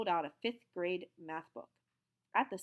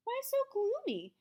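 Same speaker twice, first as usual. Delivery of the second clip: breezy librarian; 5 dB louder than usual. A narrator's reading. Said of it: "she asked".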